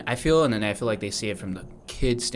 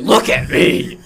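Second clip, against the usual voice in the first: high, loud smug dad; proud dad voice; 9 semitones higher, 10 dB louder, much slower than usual. Retold or imitated. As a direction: preen at the mirror. Dad voiced in mirror bit.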